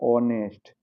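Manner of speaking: ऑनेस्ट starting straight on the vowel; no h at the front of ऑनेस्ट; silent h. Shown as a demonstration. Teacher answering "पढ़ेंगे क्या", silent h in ऑनेस्ट. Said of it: "एच silent है यहाँ पे".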